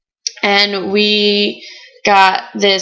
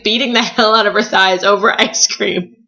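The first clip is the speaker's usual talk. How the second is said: normal talking voice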